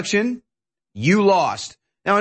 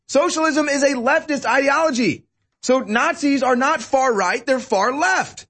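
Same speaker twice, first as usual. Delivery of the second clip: heated, higher, insistent; raised pitch rant; 7 semitones higher than usual. Real, not acted. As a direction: pitch up and argue hard.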